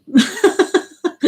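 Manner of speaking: high burst of giggling; high giggle. Real, not acted. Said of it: "I don't know why I'm laughing at that".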